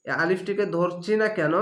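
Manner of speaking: ordinary talk, nothing marked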